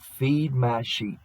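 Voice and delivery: slow, deliberate command; solemn quoting of Jesus, slowed way down; slow solemn quote. Retold or imitated. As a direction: lay each word down slowly, reverently.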